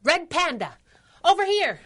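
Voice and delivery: loud, high call across a room. Acted. Sidekick calls Red Panda over to a find, calling out loud. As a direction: shout to get her partner's attention.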